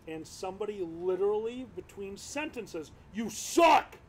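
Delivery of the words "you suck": retold heckler's yell. Heckler interrupting the comic mid-sentence, loud jeer. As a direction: call it out loudly over the act. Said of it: "deal with a straight-up heckler".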